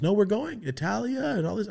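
high, excited grandma voice; a grandmother's high boasting lilt; mock grandma. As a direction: brag about the trip in grandma's high voice. Grandma quoted.

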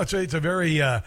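normal talking voice

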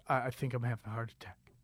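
very hushed, low, trailing away; barely voiced admission of dread; weak, stunned confession. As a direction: murmur it low, as if frozen onstage. Acts out weak confession of panic onstage.

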